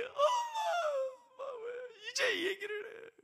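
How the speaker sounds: very high, loud crying wail; wailing daughter. Acted. Daughter learning why mother was burned, wailing. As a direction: bawl the words like a heartbroken child.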